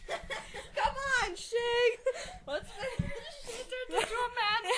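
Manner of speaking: high, giggling through the words; can barely get it out for laughing. Real, not acted. Reader cracking up mid-scene, still laughing.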